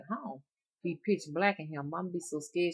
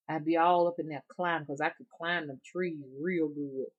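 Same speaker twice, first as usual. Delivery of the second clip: ordinary delivery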